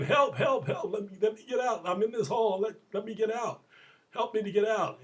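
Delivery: high, insistent mock shouting; retold yell for help. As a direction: plead repeatedly to be let out, acted. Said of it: "the man yells again".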